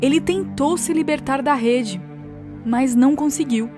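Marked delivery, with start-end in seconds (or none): none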